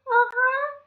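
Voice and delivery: put-on high child voice; a small agreeing uh-huh; Little Red.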